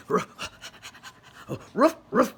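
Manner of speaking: loud barking dog. A narrator's reading. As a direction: bark it out like a happy dog. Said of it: "said the dog".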